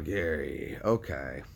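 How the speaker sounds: low, soft, let-down Gary; deflated sigh. Real, not acted. Streamer gives up on silent caller, low and deflated. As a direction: let the name sink with disappointment.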